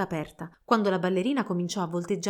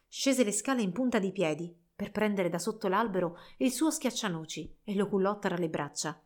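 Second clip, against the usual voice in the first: usual speaking voice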